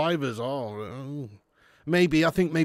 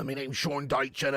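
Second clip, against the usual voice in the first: put-on West Country accent; 6 dB quieter than usual. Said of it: "Why have I gone West Country there?".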